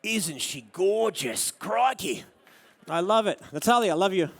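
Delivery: Australian documentary host. Acted. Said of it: "an Australian sort of documentary".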